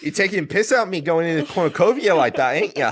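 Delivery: put-on fast, laughing Australian accent; mock Aussie while giggling. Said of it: "It was Australian".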